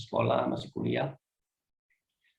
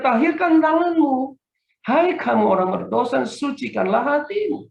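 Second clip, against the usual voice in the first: scripture quote; 10 semitones higher, 6 dB louder than usual. Acted. Quoting scripture in sermon.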